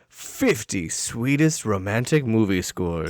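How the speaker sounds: low, slow compilation-album announcer; commercial announcer. Acted. Podcaster selling a nineties compilation album, announcer voice. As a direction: pitch it like an old TV music ad.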